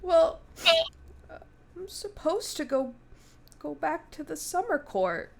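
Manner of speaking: lightly voiced fae knight, worried; a hint of a fretful knight. Knight explains the hags to the party, lightly worried.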